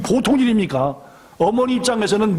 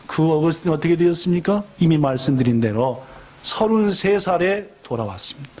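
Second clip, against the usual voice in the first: ordinary talk, nothing marked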